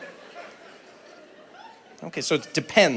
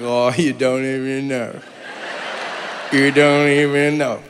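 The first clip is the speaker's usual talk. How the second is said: smug teasing; 5 semitones lower, slower than usual. Retold or imitated. Smug secret-keeper quote.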